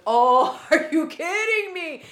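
high, loud, half-crying laugh of disbelief; retold cry-laugh of frustration; exasperated disbelief. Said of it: "And I was like".

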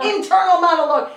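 big playful restatement; loud emphatic repeat. Shown as a demonstration. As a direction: punch out Internal monologue loud and high.

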